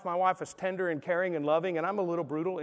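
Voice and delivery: ordinary talk, nothing marked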